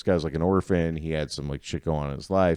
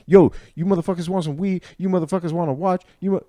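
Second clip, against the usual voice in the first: high, loud hustler pitch; street vendor's voice; 11 semitones higher, 5 dB louder than usual. Retold or imitated. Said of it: "I'm doing his exact voice".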